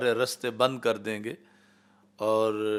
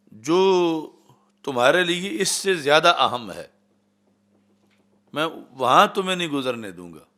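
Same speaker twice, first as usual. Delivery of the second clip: louder, a touch higher, firm counter-threat; Sa'd's angry answer to Abu Jahl's threat, louder; angry reply; 3 semitones higher, 6 dB louder than usual. Retold or imitated. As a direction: hurl the threat back without backing down. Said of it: "غصے میں آ کے آپ نے".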